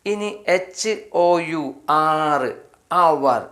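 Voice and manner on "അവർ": usual speaking voice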